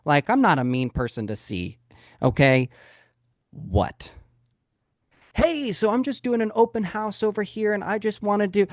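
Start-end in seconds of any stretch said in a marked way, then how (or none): 3.5-4.1 low, gruff annoyed "What?" from a man just woken up; grumpy man. Retold or imitated. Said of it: "that's how I was greeted at the door". 5.3-8.7 higher, bright door-knock greeting; cheery salesperson. Retold or imitated. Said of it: "and I'm really cheery".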